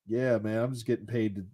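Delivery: lightly voiced [name], low and unbothered; imitating Snoop. Retelling Snoop at the show, low soft laid-back imitation. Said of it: "Snoop's like".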